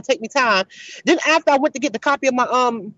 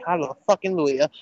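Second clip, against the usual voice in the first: relieved hallelujah; 11 semitones lower, 6 dB quieter, slower than usual. Real, not acted. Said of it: "catastrophe ended".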